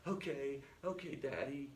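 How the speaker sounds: small, soft mock-tearful voice; tearful child. Crying five-year-old to her sorry father, meek. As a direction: play a hurt little girl giving in quietly. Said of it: "and she starts crying".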